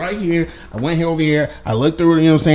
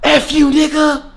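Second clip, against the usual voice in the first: high, slow mock shout through a door; imitating muffled yelling; 12 semitones higher, 5 dB louder than usual. Girl yelling at his door as it closes, mock shout. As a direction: yell it high and drawn out, like she did. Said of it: "literally what it sounded like".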